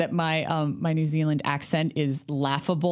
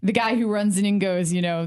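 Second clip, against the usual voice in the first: ordinary delivery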